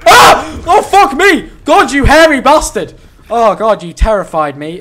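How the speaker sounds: real startled loud yelp and swearing; jump scare. Real, not acted. Gamer yelling after a monster jumps out, real fright. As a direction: shout in genuine fright.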